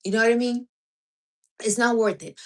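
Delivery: usual speaking voice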